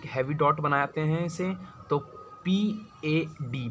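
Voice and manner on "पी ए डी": "पी ए डी" drawn out letter by letter; slowly spelled letters. Shown as a demonstration. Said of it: "पेड।". ("पी ए डी" धीरे-धीरे, एक-एक अक्षर अलग)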